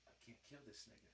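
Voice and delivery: hushed guilty whisper; whisper, squeamish about killing. Retold or imitated. Cracking the crab claw, he whispers his guilt. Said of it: "I was like".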